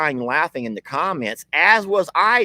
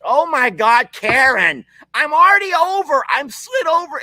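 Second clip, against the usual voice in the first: high, louder, exasperated protest at the animal; exasperated high outburst; 12 semitones higher, 7 dB louder than usual. Real, not acted. Squeezed aside by the animal, a high exasperated protest. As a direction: squeal it like you're being crowded out.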